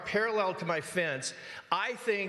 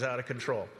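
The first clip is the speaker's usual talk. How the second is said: usual speaking voice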